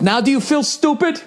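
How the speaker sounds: high, sneering mock of the driver; smug taunt. Rude driver voiced in story.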